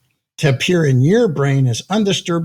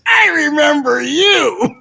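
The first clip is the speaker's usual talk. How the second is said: high, slow, laughing and louder; laughing recognition; 10 semitones higher, 4 dB louder, slower than usual. Retold or imitated. Steve recognizes the speaker, laughing high and loud. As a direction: burst out laughing as you say it. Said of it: "he goes".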